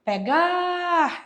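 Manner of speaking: Pegar with GAR stretched long and high; stressed syllable demo. Shown as a demonstration. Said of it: "ela é oxítono". (Pegar com GAR bem alongado e agudo)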